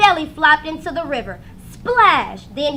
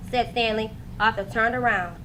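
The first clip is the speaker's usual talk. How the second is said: plain reading voice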